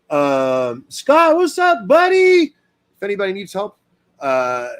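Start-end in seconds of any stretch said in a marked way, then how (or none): 0.9-2.5 high, bright-voiced hello to a friend; greeting a viewer in the live chat, excited; cheerful greeting. Real, not acted. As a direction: call out happily to a buddy.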